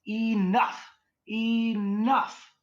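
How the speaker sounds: high, loud, drawn-out imitation; nice spirit's voice. Imitating the protective spirit on a recording, high insistent call. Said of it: "you hear the nice guy go".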